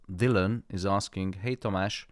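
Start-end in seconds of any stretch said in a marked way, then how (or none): none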